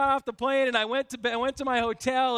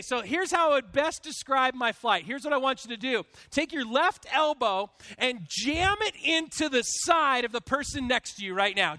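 usual speaking voice

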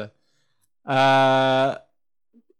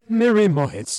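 raised, garbled attempt at a name; higher-pitched smudged words; reversed-recording imitation; 6 semitones higher, 4 dB louder than usual. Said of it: "you sounded like this".

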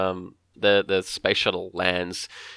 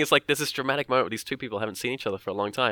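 ordinary delivery

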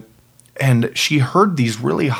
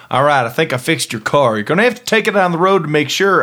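quicker mock Southern mechanic; Southern accent; 3 semitones higher, faster than usual. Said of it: "somebody in a Southern".

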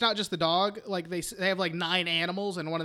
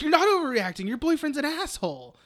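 higher-pitched mock indignation; indignant retort; 8 semitones higher than usual. Answering a needless apology, indignant retort. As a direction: push back high, as if outraged for her.

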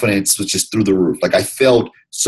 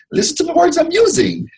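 imitating the manager. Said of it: "he'd be like".

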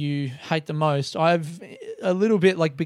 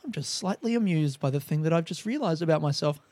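mock-serene, slightly amused guide; Andy; 4 dB quieter than usual. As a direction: imitate a meditation teacher's mild amusement. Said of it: "it's, like, a little bit funny".

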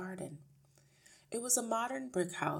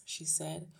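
ordinary delivery, nothing marked

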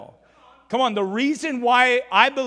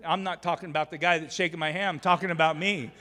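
ordinary talk

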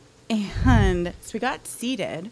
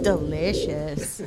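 put-on savouring kid; 4 semitones lower, slower than usual. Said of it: "every spoonful he's like".

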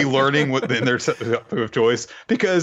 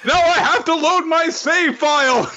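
high, hammed-up exasperation; comic high-pitched cursing; mock frustrated outburst; 4 dB louder than usual. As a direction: wail it like a thwarted game nerd. Same joke, character's frustration.

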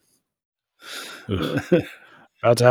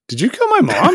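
shocked high squeak; 12 semitones higher than usual. Retold or imitated. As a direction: voice jumping way up in shock. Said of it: "he's like".

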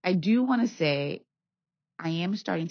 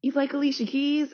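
high, eager question; excited scooter driver; 10 semitones higher, 5 dB louder than usual. Retold or imitated. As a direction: ask like a chatty fan. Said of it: "He's like".

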